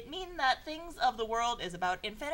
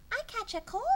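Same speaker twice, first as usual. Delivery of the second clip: princess voice; 12 semitones higher than usual. Acted. Princess line in read fanfic.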